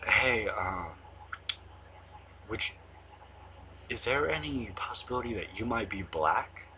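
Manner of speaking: a hint of a low, hushed teacher, near a whisper on "Hey, um"; economics teacher calling a student over, hushed and low. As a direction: lightly imitate the teacher leaning in quietly. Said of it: "He talks, like, low, so I'm gonna just, like, try to replicate it".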